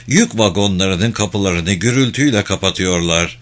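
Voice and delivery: ordinary delivery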